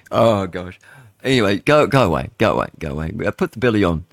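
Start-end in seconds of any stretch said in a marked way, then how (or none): none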